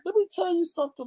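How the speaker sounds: high sassy aside. Retold or imitated. Vlogger's high mock comeback to viewers. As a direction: pitch it up with attitude. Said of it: "I'm like".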